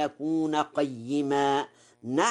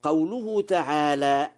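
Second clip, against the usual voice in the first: ordinary talk, nothing marked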